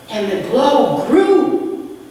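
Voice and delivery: usual speaking voice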